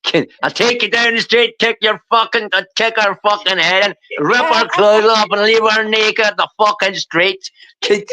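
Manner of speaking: put-on Irish accent, pitched high. Showing off the accent Maddie laughs at, Irish accent. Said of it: "when I put on an Irish accent".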